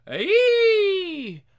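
piercing high-pitched scream. Acted. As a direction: shriek in terror. Scream from the cemetery.

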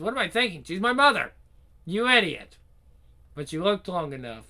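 loud, high alarmed self-rebuke; self-scolding. Acted. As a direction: snap at yourself in shock. Scolding himself in panic.

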